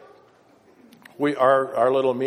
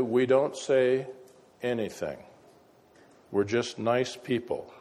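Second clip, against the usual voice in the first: normal talking voice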